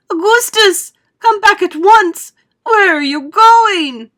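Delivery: high, loud, stretched-out wail; shouting. A narrator's reading. Mother shouts after her son in the pipe. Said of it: "shouted Missus Gloop".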